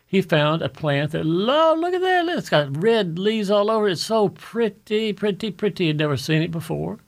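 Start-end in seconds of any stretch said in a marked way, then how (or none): 1.3-5.9 acted amazement. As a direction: marvel aloud, stretching the words. Voicing botanist finding a pretty plant.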